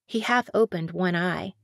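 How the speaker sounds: usual speaking voice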